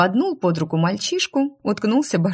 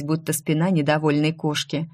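ordinary delivery, nothing marked

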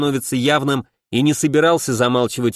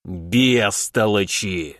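slow, low muttered insult; angry through teeth; 4 semitones lower, much slower than usual. A narrator's reading. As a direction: grit it out angrily. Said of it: "скрипнул зубами".

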